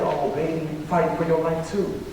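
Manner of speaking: soft, retold motherly reassurance; tender reply. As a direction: gently turn it back on the student. Sick teacher gently turns it back.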